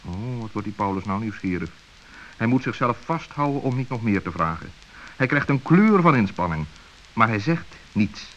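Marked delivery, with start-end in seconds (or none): none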